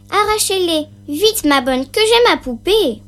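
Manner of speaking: put-on a child who can't wait for her doll; impatient little girl. A narrator's reading. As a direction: Sophie pleading her nanny to hurry. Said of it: "impatient Sophie wants doll".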